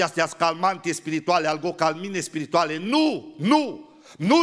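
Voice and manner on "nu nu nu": emphatic no. Real, not acted. Preacher forbids emphatically.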